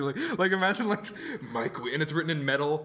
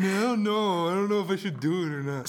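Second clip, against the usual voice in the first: whiny mock voice; 3 semitones higher than usual. Mocking friend's indecision.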